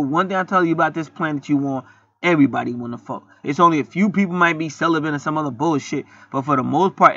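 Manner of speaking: normal talking voice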